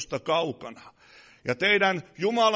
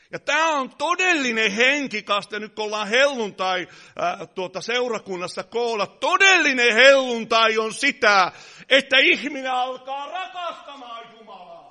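loud, driving sermon voice; preaching at full force; 7 semitones higher, 9 dB louder than usual. Acted. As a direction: hammer it home to the pews. Pentecost climax of sermon.

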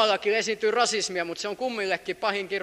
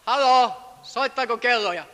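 ordinary delivery

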